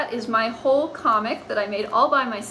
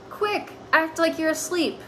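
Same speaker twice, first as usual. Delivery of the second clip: urgent cat; 6 semitones higher than usual. Acted. Cat urging hiding.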